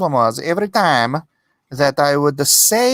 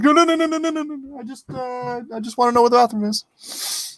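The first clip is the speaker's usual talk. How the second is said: panicked tourist; 8 semitones higher than usual. Retold or imitated. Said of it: "I'm like".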